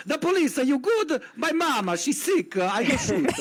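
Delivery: high, hammed-up Italian accent; mock Italian accent. Mocking Luigi's note in a cartoon Italian accent. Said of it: "So he's like".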